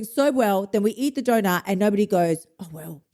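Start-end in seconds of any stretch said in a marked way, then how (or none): 2.6-3.0 very soft, low shrug; mild resignation. Acted. Imagined dieter after a donut, soft unbothered resignation. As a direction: let it go quietly. Acted carefree response nobody gives.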